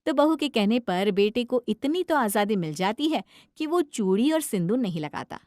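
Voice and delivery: usual speaking voice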